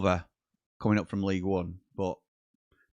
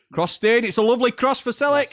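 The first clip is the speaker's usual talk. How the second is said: excited commentary; 13 semitones higher, 6 dB louder than usual. Real, not acted. Live match commentary, excited call.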